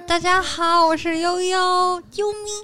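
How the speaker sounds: put-on high, slow, sugary baby talk; cute girly voice. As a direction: coo it like a cutesy girl. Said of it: "他们两个人在发出那种 “喵喵".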